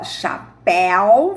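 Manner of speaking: "chapéu" said slowly with a wide-open é; open vowel demo. Shown as a demonstration. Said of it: "você abre bem a boca pra falar". ("chapéu" com o é bem aberto, devagar)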